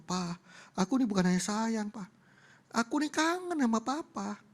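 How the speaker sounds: put-on high-pitched child, drawing out the longing; affectionate daughter. Daughter hugging her father, affectionate and drawn out. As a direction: plead your love in a little girl's voice.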